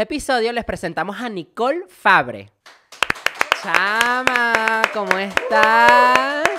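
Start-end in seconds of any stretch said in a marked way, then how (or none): 3.6-6.5 loud, slow, stretched hello to the guest; drawn-out loud "Chama, ¿cómo estás?"; big on-air greeting. Real, not acted. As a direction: welcome her like a radio host. Host greets new guest on air.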